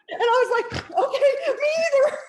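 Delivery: high, loud, fast laughing shout. Retold or imitated. Author quoting her reply, shrieking with laughter. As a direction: let the joke burst out in a laughing yell.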